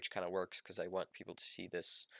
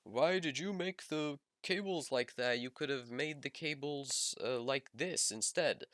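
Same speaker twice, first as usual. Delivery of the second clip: higher, whiny critic voice; mocking commenter voice; 6 semitones higher than usual. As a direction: nitpick like an annoying viewer. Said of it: "If you have any criticisms, such as".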